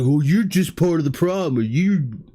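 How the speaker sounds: mock finger-pointing rant; accusatory. Imitating an angry commenter attacking anyone who disagrees, accusatory. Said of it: "and be accusatory".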